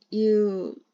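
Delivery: ordinary delivery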